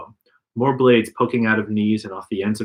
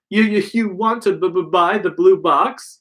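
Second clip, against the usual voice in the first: stammering; 7 semitones higher than usual. A narrator's reading. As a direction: stammer it out, frightened. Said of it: "you stammer".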